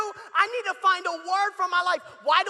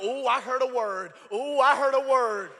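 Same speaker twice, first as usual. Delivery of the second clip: lower, marvelling "ooh"; mock revelation; 4 semitones lower than usual. A friend hearing the mom's complaint as a word from God, in mock awe. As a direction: ham up a holy realization.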